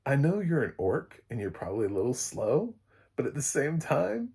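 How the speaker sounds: ordinary talk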